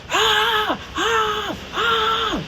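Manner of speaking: high, shrill-free mock scream burst. She relives screaming in her dream, high mock screams.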